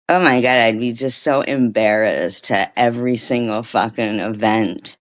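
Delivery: usual speaking voice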